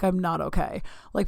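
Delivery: ordinary talk, nothing marked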